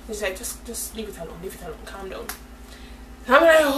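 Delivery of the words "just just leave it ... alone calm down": hushed calming. Retold or imitated. Mother telling her daughter to drop it, hushed calming. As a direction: quietly shush her down.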